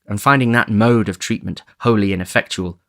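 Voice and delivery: ordinary delivery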